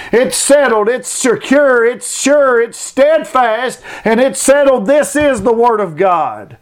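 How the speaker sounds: fiery revival preaching; preaching at full force. Acted. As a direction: drive each "settled" home to the pews. Preacher builds to high fervor.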